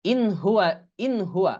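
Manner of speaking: "in huwa" with a crisp n; clear "in", no ghunna. Shown as a demonstration. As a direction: model "in huwa" without nasal hold. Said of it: "ইখফা করা লাগে না". ("ইন"-এর নুন গুন্না ছাড়া স্পষ্ট)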